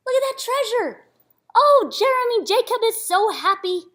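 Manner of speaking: high gasping wonder; excited gasp. Real, not acted. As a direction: marvel at the treasure. Reader marvels at the treasure.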